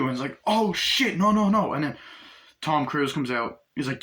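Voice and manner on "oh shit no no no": high, loud, panicked protest; acted panic. Driver reacting to the gun threat, acted panic. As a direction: blurt it out in mock alarm.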